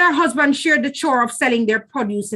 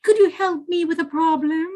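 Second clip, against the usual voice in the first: sweet, coaxing spider, pitched higher; butter her up with a honeyed greeting; sly trickster sweetening his tone; sugary voice; 6 semitones higher than usual. A narrator's reading. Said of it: "in his sugary voice".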